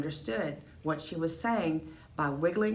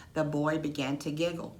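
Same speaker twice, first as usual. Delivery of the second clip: plain reading voice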